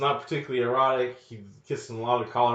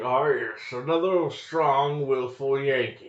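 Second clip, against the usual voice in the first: slow, raised British officer voice; impressed Englishman, hammed up and drawn out; mock British; 4 semitones higher, 3 dB louder, slower than usual. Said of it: "These British guys are really impressed".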